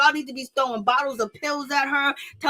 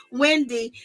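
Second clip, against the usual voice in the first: ordinary talk, nothing marked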